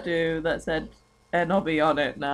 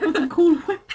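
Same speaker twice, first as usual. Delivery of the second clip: laughing mock; 12 semitones higher, 4 dB louder than usual. Mocking the H, laughing.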